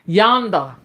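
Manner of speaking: YONDER without its final R, "YON-dah"; a loud drawled "YON-dah"; Southern drawl. Shown as a demonstration. Mom showing off her dialect, yonder as "YON-dah". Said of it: "using the word yonder".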